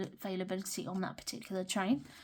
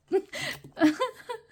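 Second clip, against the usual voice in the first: high laugh; 14 semitones higher, 5 dB louder than usual. Real, not acted. Laughing after the word.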